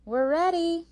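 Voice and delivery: high, loud, stretched call. A narrator's reading. May May calls to her sister from the horse, calling out. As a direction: call out brightly to announce you're ready. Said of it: "she called".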